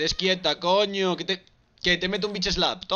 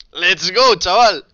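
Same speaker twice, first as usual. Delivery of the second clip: hype shout; 2 semitones higher, 12 dB louder, much slower than usual. Real, not acted. Hyping the match start.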